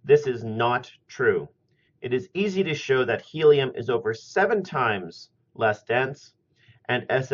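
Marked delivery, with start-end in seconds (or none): none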